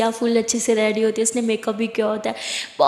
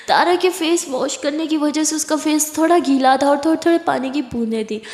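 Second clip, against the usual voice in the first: plain reading voice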